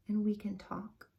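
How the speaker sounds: whispered gently; soft whisper. Acted. Grandfather calms the upset boy in a whisper. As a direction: let it fade to a whisper.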